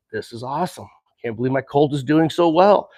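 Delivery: softly, holding excitement in check; calm voice of reason. Retold or imitated. Rider stays calm for the colt, quiet and even. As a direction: keep the thrill under a calm hush. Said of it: "be the calm voice of reason".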